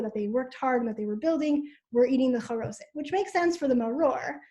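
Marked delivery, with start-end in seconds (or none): none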